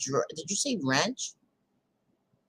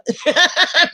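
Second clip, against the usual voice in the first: bright laugh; 9 semitones higher, 9 dB louder than usual. Real, not acted. Streamer bursting out laughing at a viewer's comment.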